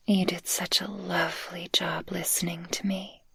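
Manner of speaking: soft close whisper, soothing the listener; ASMR whisper. Acted. Speaker cradles the listener after excitement, tender whisper. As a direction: breathe it gently into their ear.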